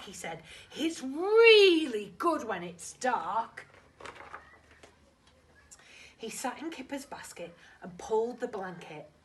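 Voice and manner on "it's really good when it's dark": put-on loud, slow, eager young tiger; excited Tiger voice. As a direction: stretch it out with big enthusiasm. Tiger voiced in read-aloud story.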